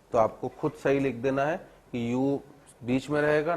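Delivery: usual speaking voice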